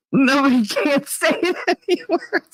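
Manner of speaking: high, squeaky laughing voice; cracking up while talking; real laughter. Real, not acted. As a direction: squeal the words out through a laugh. Laughs at what was said.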